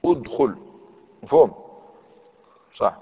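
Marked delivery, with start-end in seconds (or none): 0.1-0.6 أدخل said udkhul, opening with u; damma on hamza. Shown as a demonstration. Teacher giving the damma example, clear opening u. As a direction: sound both vowels of أدخل as u. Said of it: "مضمومة، كأدخل".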